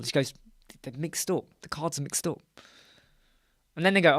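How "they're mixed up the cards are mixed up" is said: softly mumbled cover-up; sheepish mock excuse. Host excusing the cards, soft sheepish mock excuse. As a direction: act a host quietly fobbing someone off.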